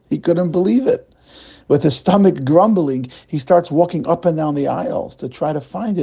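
usual speaking voice

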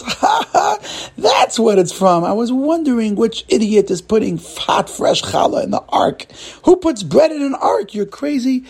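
high, incredulous hooting; laughing hard. Acted. Cleaner realizing where the bread came from, laughing ridicule. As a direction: crack up with acted laughter and ridicule him. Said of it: "laughs so hard and says".

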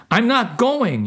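high, stubborn refusal; mock yell. A narrator's reading. Soul refusing the mission, high stubborn yell. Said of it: "you yelled".

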